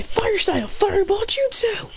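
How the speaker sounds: high, slow, drawn-out jutsu shout; high ringing attack name; anime attack call. Acted. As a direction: call the move out like an anime hero. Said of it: "Then he goes".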